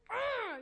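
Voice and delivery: loud, high exasperated argh; frustrated growl. Retold or imitated. Mom at her wits' end with toddler, loud argh. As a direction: let out a fed-up roar. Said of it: "blood pressure rising".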